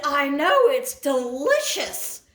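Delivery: high, gleeful holler back; re-enacted long-distance call; calling out loudly. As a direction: shout it across the yard, drawing it out. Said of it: "I said loudly across the dark abyss".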